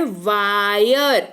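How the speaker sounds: "वायर" stretched very slowly on आय; drawn-out आय sound. Shown as a demonstration. Teacher lets learners hear आय in wire, drawn-out demo. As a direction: draw out "वायर" so the आय glide is heard. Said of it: "सुनाई दे रहा है?".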